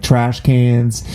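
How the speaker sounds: ordinary delivery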